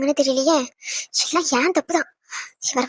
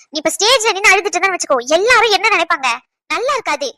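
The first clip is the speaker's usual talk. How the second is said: louder, higher pleading to stop crying; insistent, raised scolding comfort; firm urging; 4 semitones higher, 5 dB louder than usual. Acted. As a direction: press her to pull herself together.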